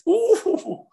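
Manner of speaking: high, loud yelp of outrage; shocked yelp. Retold or imitated. Woman hearing the taxi driver is praying, a high yelp. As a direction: let out a sharp shocked cry.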